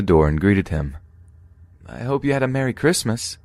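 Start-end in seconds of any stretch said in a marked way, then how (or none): 1.8-3.4 higher-pitched, excited holiday greeting; almost excited. A narrator's reading. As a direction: greet the neighbor with lifted, eager pitch. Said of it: "in an almost excited voice".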